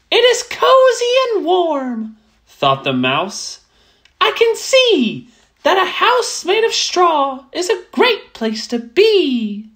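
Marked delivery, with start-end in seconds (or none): 0.1-2.2 high, pleased little mouse voice; a small mouse admiring a cozy hut. Acted. Mouse admiring the straw hut, high and content. 4.2-9.7 high, pleased little mouse voice; a small mouse admiring a cozy hut. Acted. Mouse admiring the straw hut, high and content.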